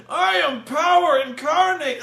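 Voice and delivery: high, slow mock shout into a grunt; gloating villain cut short. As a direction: bellow the boast, then take the blow. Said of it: "He, like".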